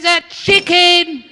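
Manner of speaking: high, loud, drawn-out mock shriek; hammed-up yelling. Wife at the peak of a silly quarrel, hammed-up shrieking. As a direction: screech it slowly in comic outrage. Acted couple's escalating argument.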